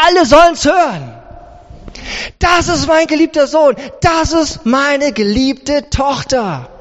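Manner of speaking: big, slowing, jubilant declaration; proud proclamation. Acted. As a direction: announce it for all to hear. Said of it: "reißt den Himmel auf und sagt". (feierlich laut verkündet)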